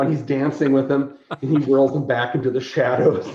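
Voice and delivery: ordinary talk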